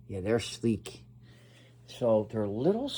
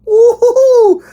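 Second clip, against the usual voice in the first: high, loud laughing whoop; gleeful falsetto whoop; excited whoop; 17 dB louder, slower than usual. Real, not acted. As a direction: whoop with glee at the package.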